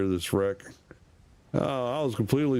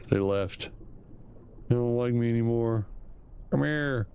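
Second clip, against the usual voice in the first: ordinary talk, nothing marked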